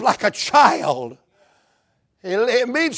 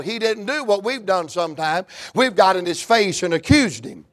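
ordinary talk, nothing marked

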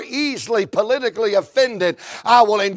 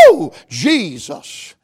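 low, slow preacher's whoop; whoop of praise; 4 semitones lower, slower than usual. Retold or imitated. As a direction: let out a drawn-out revival whoop. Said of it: "literally I could say".

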